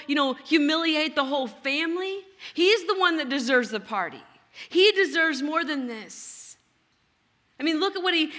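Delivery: ordinary talk, nothing marked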